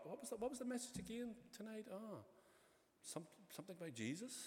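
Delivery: quick, soft, acted puzzlement; forgetful, unsure. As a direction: grope quietly for a half-remembered answer. Forgetful churchgoer groping for the message.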